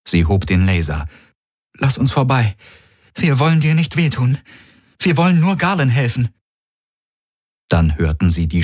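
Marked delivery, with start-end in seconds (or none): 1.8-6.3 put-on higher-pitched young heroine voice; urgent, raised-pitch appeal; heroine pleading to pass. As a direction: plead to be let through, voice lifted.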